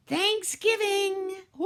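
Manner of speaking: drawn-out, high, loud mock celebration; mock cheer. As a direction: ham up the holiday joy. Mock cheerful holiday ad exclamation.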